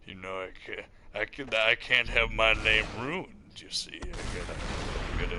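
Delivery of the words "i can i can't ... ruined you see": normal talking voice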